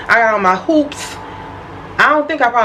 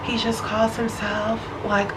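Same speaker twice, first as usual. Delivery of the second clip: whispered friend imitation; 10 dB quieter than usual. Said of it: "it's like".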